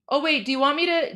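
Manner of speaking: ordinary delivery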